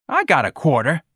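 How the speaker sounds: put-on high, sneering street kid. A narrator's reading. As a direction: sneer it like a cocky boy.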